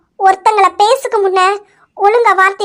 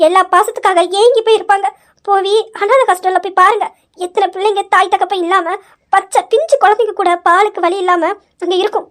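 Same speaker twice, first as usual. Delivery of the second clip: usual speaking voice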